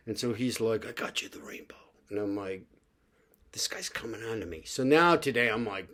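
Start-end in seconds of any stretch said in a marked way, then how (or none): 0.9-1.8 whispered, voiceless quote of the clerk. Retold or imitated. Retelling the shop clerk's gift in a whisper. Clerk's kind words retold. 3.5-4.6 hushed aside. Retold or imitated. Inner thought about the clerk.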